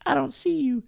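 high, put-on falsetto; very fake voice. Date on speakerphone claims not to see him, fake falsetto. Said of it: "like, very fake sounding voice".

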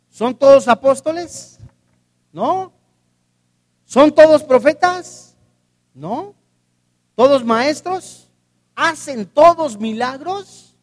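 loud, rising rhetorical questions; loud rhetorical preaching. Acted. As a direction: hammer each question at the congregation. (preguntas retóricas predicadas con fuerza)

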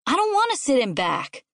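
put-on high-pitched kid's refusal; voiced child's protest; balking child. A narrator's reading. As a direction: dig in like a stubborn kid. Child refusing the back seat.

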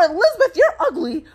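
high mock insult from a kid; imitating a hostile little brother; aggressive little brother. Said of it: "like, really aggressively".

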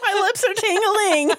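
giggling words; laughing. Real, not acted. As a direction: laugh while speaking.

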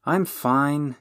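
a touch of apology; contrite. A narrator's reading. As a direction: reassure them contritely. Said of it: "said Marion contritely".

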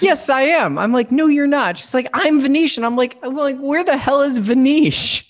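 high, loud retold back-and-forth squabble; heated mock argument. Retelling her Venetian argument with a friend, loud squabble. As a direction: replay the silly argument, both sides high and loud. Retold quarrel, both voices acted.

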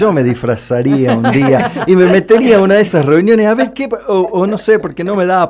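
giggling while joking; laughing. Real, not acted. Joking about sneaking into meetings.

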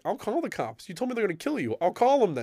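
high-pitched, insistent mock warning; retold threat. Manager threatens troublesome man with police, retold warning. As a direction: pitch it up like an exasperated ultimatum.

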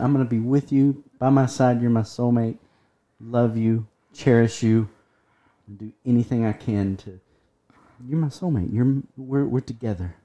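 soft, low, tender devotion; love bombing. Pretend. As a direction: pour on the sweet promises gently. Pledging devotion to a soulmate.

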